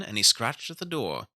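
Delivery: usual speaking voice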